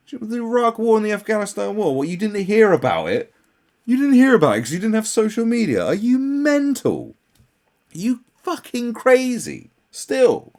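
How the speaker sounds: high, loud disbelieving outburst; incredulous rant. Real, not acted. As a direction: shout at her in disbelief. Angry rant at the excuse.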